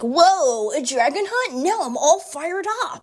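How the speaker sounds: high-pitched mock excitement; Natsu. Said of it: "And then Natsu's like".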